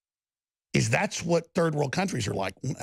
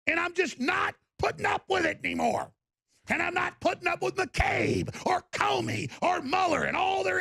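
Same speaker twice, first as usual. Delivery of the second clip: furious shouting; 8 semitones higher than usual. Real, not acted. A host screaming at his enemies, full fury. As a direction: rage at them as if they stand before you.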